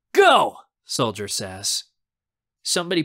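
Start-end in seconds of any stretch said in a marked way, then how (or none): 0.1-0.6 high, loud start signal; shouted command. A narrator's reading. Friend gives the team its head start, shouting. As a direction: shout it to set them off. Starting call in paintball game.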